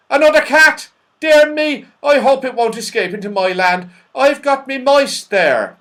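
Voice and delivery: high, loud character voice in alarm; fretful Saucepan Man. A narrator's reading. Saucepan Man fears for his mice, high and fretful. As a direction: fret loudly in a high, comic voice.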